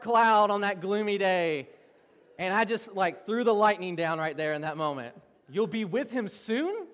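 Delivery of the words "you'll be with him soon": high, slow, incredulous question; mock disbelief. Pastor mocking what he told his grandma, loudly incredulous. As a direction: replay the blunder as an appalled question.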